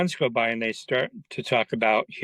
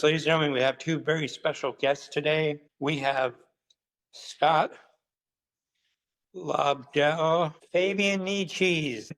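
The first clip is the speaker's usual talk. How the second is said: raised-pitch announcer, stretching out the names; event announcer; 5 semitones higher than usual. Retold or imitated. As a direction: announce guests to a big crowd. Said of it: "the guy goes up to introduce".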